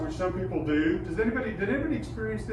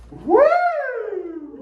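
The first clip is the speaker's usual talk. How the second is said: high, loud falsetto whoop; falsetto woo; 8 dB louder than usual. Shown as a demonstration. Choir teacher modelling falsetto again. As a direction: throw it way up. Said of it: "Throw it way up there".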